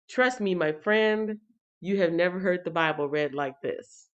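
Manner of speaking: ordinary talk, nothing marked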